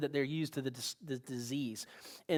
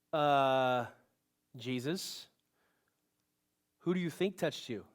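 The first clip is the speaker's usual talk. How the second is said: put-on sarcastic teenager; 4 semitones lower, slower than usual. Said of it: "like a sarcastic little teenager".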